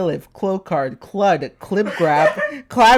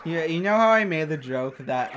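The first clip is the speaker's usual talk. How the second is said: usual speaking voice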